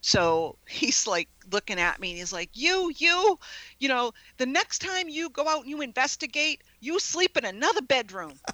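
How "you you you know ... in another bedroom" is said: imitating angry husband. Husband scolding his wife after the haunted night, mock angry. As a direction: retell the husband's annoyed order, a bit hammed-up. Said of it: "my husband was so angry with me".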